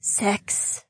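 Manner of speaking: barely voiced confession; whispered. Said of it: "she nearly whispered".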